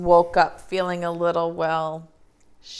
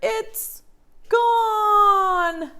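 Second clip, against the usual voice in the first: crying; 11 semitones higher, 3 dB louder than usual. Acted. Baby bear crying.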